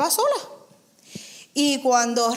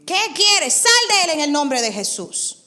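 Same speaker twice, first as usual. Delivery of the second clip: loud, higher commanding rebuke; 5 semitones higher, 4 dB louder than usual. Acted. Preacher commanding the demon to leave, loud. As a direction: order the demon out with authority.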